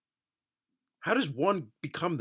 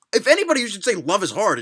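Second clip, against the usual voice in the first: ordinary talk, nothing marked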